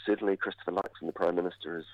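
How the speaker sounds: usual speaking voice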